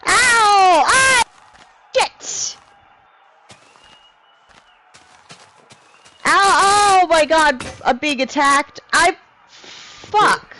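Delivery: high, loud yelps of pain; pained yelling. Real, not acted. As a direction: cry out as if suddenly hurt. Attacked in game, yelping.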